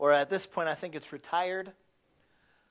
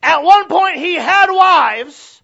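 higher, louder, slow shouted repeat; full shout; 12 semitones higher, 18 dB louder, slower than usual. Acted. Preacher repeating his point about David's wives, shouting. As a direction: hit it harder the second time.

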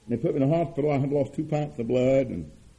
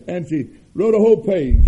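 laughing through the words; 2 semitones higher, 7 dB louder than usual. Real, not acted. Preacher amused, telling the church about the poem, laughing. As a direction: say it while still chuckling.

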